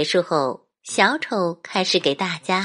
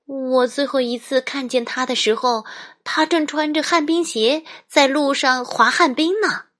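higher, light mother voice; mom voice; 8 semitones higher than usual. Acted. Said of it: "妈妈回答说".

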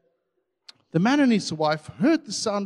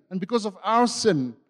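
ordinary talk, nothing marked

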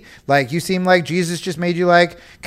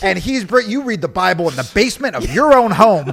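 mock offended liberal; 5 semitones higher, 4 dB louder than usual.

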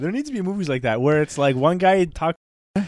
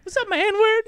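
imitating Chris Tucker. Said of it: "He's like".

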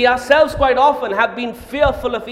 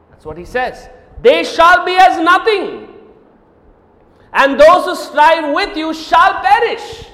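triumphant preaching; 4 semitones higher, 6 dB louder than usual. Acted. Preacher declaring the enemies' defeat at full force. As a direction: thunder the victory.

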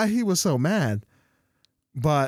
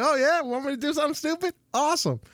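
put-on higher, fast, eagerly dumb kid voice; mock eager kid; 9 semitones higher, faster than usual. Young fool agreeing to a dare, mock eager and fast. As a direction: play the kid who jumps at any stupid dare.

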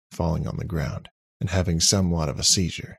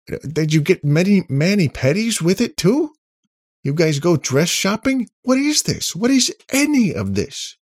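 very high, loud incredulous rant; mock them with escalating, squeaky outrage; exasperated high-pitched questioning; outraged disbelief; 14 semitones higher, 7 dB louder than usual. A narrator's reading. Nick's outraged mocking questions.

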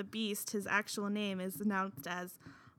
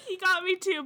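laughing; 10 semitones higher, 12 dB louder than usual. Real, not acted.

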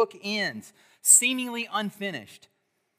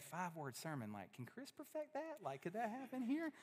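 much softer, hushed inner voice; imagined listener; 3 semitones higher, 20 dB quieter than usual. Pretend. Pastor voicing what the church quietly thinks, hushed. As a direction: voice the thought under your breath.

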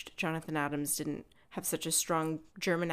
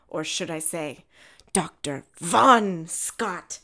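dramatic reveal; 7 dB louder than usual. Retold or imitated. Acting Frank's dramatic reveal.

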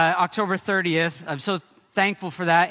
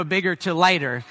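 normal talking voice

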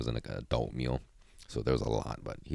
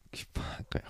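whispered curse; 10 dB quieter than usual. Real, not acted. Annoyed muttering.

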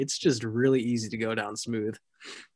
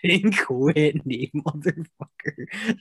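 laughing drawl; much slower than usual. Real, not acted. Drawn-out laughing exclamation.

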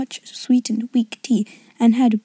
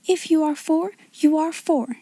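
put-on higher-pitched little girl's reproof; severe; 6 semitones higher than usual. A narrator's reading. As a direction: scold primly in a raised, girlish pitch. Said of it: "she said severely to Lavinia".

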